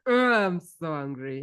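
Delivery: slow, drawn-out growl of frustration; playfully acted exasperation; mock angry. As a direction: ham up being angry, stretching "Oh". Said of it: "What if you're actually angry?".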